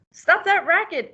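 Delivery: duck interrupting. Acted. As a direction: snap it out like an annoyed duck. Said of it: "interrupted the duck".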